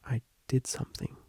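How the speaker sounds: soft near-whisper confession; girl admitting a secret to her tutor, hushed. Acted. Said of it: "she whispered".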